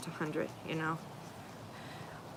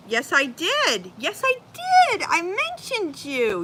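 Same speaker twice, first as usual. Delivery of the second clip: excited insisting; 8 semitones higher, 5 dB louder than usual. Real, not acted.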